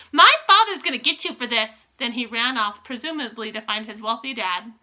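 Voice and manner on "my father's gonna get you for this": high, loud bratty shriek; outraged yell, pitched up; shrieking threat. A narrator's reading.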